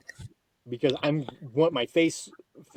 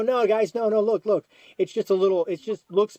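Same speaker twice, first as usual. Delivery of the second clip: protesting; 6 semitones higher, 3 dB louder than usual. Retold or imitated. Refusing the hospital, retold.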